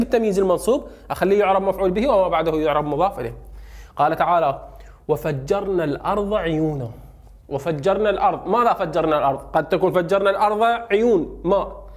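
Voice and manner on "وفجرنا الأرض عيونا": Quran recital. Shown as a demonstration. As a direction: recite the verse slowly and reverently. Teacher quotes Quran verse. (تلاوة هادئة بطيئة)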